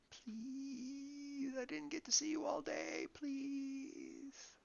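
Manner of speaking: put-on very high, soft little girl begging; soft pleading. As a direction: whimper a quiet please. Little sister pleading softly.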